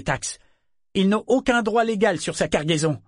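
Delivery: high-pitched, emphatic public appeal; earnest declaration. A narrator's reading. Althea appealing to the council for aid, emphatic. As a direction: declare the case firmly to the room.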